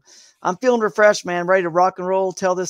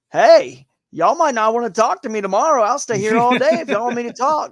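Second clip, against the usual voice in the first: imitating a teammate's Alabama accent, higher and louder; 6 semitones higher, 5 dB louder than usual. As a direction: Alabama accent, offering to talk all day. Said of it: "Justin's kinda from Alabama. He's got the accent".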